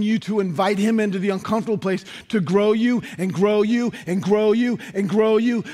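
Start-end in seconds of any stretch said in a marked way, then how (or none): 3.3-5.6 preaching at full force. Acted. Pastor rallying the church toward a God year, shouted preaching climax. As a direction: drive the congregation up to the climax.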